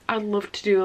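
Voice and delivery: normal talking voice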